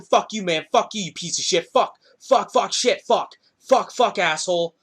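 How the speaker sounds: ordinary talk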